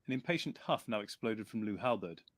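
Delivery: usual speaking voice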